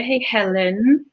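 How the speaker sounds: ordinary talk, nothing marked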